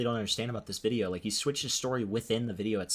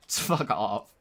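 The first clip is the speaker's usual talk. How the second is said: laughing. Real, not acted. Laughing at a chat joke.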